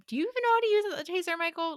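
high-pitched, rapid mock condescension; condescending. Repeating his doubt about the taser, hammed-up condescending. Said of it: "he's, like, very condescendingly".

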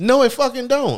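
high, loud blunt retort; loud exclamation. Real, not acted. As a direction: shout it back, incredulous. Mock blunt rejection.

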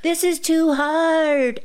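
slow, high put-on critter voice; slow high cartoon worm voice. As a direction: drag it out like a fooled little worm. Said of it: "And he goes like".

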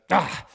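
sharp loud "Ah!" of annoyance; an exasperated loud huff; frustrated grunt. Retold or imitated. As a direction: bark out a fed-up grunt. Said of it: "And then he goes".

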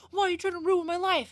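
high-pitched imitation of a daughter's tantrum; mock angry outburst. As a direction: whine it accusingly at a parent. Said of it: "mad at her mom and was like".

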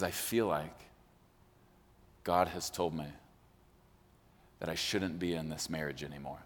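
low, soft and halting solemn confession; serious. Retold or imitated. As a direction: imitate a husband gravely confessing, with long heavy pauses. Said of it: "It seems serious".

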